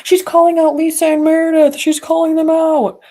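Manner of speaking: hammed-up impressed viewers; mock fan voice. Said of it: "and people are like".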